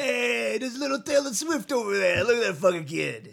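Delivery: loud high jeering voice; mocking heckler. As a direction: shout it like a mean kid. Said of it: "They're not comparing, like".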